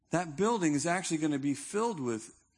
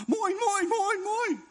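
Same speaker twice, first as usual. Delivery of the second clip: high, loud squawking cries; a flock of seagulls squawking; 7 dB louder, slower than usual. Retold or imitated. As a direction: peck at the listener like greedy gulls.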